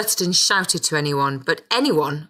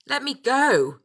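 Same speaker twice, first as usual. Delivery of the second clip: higher-pitched cry without added volume; a narrated shout, pitched up but held back; restrained shout; 8 semitones higher, slower than usual. A narrator's reading. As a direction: demand release in a mild raised-pitch shout. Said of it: "he shouted in my face".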